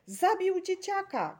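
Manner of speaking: high, loud, drawn-out shriek of accusation; horrified mother shrieking an accusation; piercing cry. A narrator's reading. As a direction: cry it out in horror. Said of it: "krzyknęła przeraźliwie mama Doroty".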